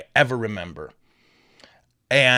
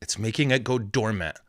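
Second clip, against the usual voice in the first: ordinary talk, nothing marked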